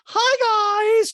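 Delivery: very high, loud mock greeting; Dorit impersonation. Host mimics housewife greeting two friends, loud high voice. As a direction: sing out a caricatured high hello. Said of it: "And Dorit goes".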